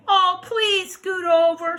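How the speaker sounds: high, slow, loud begging; pleading frog. Acted. Frog begging the animals for room, high and pleading. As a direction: beg in a drawn-out high voice.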